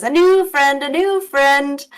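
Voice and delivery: high, loud goblin chant; gleeful goblin. Acted. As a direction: crow it high like a dancing goblin jester. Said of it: "dancing around you and he says".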